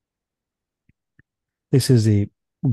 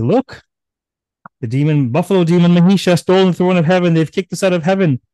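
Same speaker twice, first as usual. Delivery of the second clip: loud, fast, higher outcry of upset gods; agitated complaint; 8 semitones higher, 5 dB louder, faster than usual. Acted. Gods rushing to tell Vishnu about the theft, loud and hurried. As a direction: blurt it out in a panic, loud and quick.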